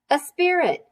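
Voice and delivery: put-on high, eager child's answer; kid's answer. A narrator's reading. Pupil answering the teacher, high and eager. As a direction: pipe up like an eager kid.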